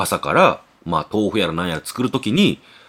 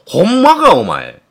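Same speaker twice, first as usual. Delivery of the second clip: much higher and louder, startled doubt; incredulous; 6 semitones higher, 6 dB louder, slower than usual. Retold or imitated. The father doubts the chef's claim, loudly and incredulously. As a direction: jolt up in disbelief.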